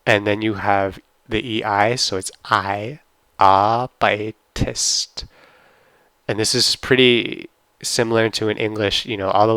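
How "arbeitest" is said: "arbeitest" drawn out slowly, ending in a rise; German pronunciation. Shown as a demonstration. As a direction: slowly model "arbeitest" for the class. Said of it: "German pronunciation demo".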